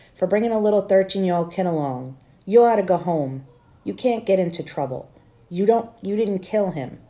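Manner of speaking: ordinary delivery, nothing marked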